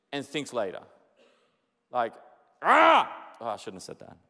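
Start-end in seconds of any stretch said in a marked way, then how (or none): 2.6-3.1 startled yelp. Acted. Acting out a hurt reaction.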